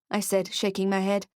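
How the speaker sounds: ordinary delivery, nothing marked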